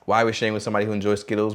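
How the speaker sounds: fast rhetorical question; quick self-answering aside to listeners; rapid aside. Acted. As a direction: rattle it off quickly.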